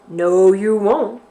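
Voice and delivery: loud, slow bear snarl. Acted. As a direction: snarl it at the boy. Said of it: "snarled the bear".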